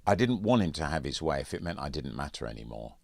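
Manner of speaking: ordinary delivery, nothing marked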